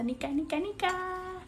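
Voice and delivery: high, playful name-chant; excited calling out. Real, not acted. Greeting a sister-friend not seen in so long, excited high calling.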